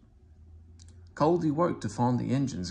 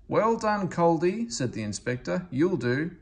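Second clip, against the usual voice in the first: much higher, louder approving voice; an inspector giving gruff praise; pleased inspector; 8 semitones higher, 6 dB louder than usual. Acted. Inspector approves Culdee after the brake test, hearty praise.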